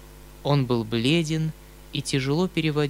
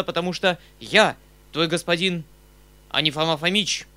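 loud, high, hammered-out orders; angry commanding outburst; furious shout; 4 semitones higher, 6 dB louder than usual. A narrator's reading. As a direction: rage at a servant as his master. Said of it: "с яростью закричал он".

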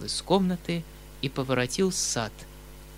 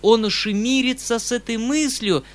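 in despair; 7 semitones higher, 6 dB louder than usual. A narrator's reading. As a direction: wring it out in despair. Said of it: "сказал я в отчаянии".

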